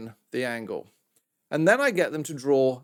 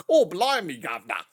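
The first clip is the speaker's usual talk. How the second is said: bad Australian-made British accent. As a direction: parody a terrible British accent. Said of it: "what the Australians try and do when they do my British accent".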